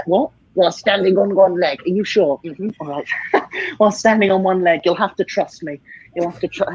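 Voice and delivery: ordinary talk, nothing marked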